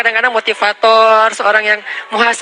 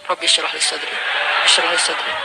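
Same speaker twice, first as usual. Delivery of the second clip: softer, lower muttered repeated prayer; mumbled prayer; 6 semitones lower, 5 dB quieter than usual. Retold or imitated. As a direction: mumble it under your breath. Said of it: "ngapain sih komat-kamit".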